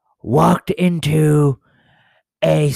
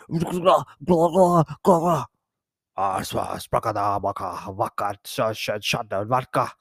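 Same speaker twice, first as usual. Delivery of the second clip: mock Russian babble, dropping lower and softer; fake Russian gibberish; 3 semitones lower, 5 dB quieter than usual. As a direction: babble fake Russian nonsense ending on vodka.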